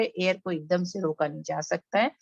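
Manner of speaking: usual speaking voice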